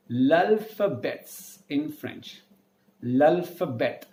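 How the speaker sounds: l'alphabet said slowly the French way, lah-fah-BAY; teacher models l'alphabet with French sounds; French pronunciation. Shown as a demonstration. Teacher shows students French pronunciation slowly. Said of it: "which is called as".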